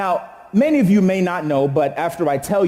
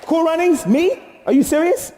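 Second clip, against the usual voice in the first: mock outrage; 10 semitones higher than usual. Outraged at the movie quote.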